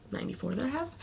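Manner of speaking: whispered aside. Real, not acted. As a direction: drop to a whisper. Aside while reading model.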